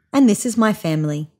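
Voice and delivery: usual speaking voice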